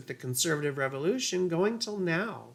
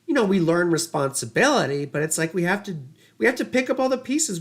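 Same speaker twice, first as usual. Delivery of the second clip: ordinary talk, nothing marked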